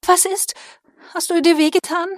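worried. Acted. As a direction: ask anxiously, full of worry. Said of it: "Minellas Stimme war mit Besorgnis erfüllt".

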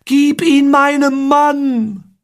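high, drawn-out cry; shouted plea. A narrator's reading. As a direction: cry it out high, stretching every word. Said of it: "Amoret schrie mir zu". (hoch und langgezogen geschrien)

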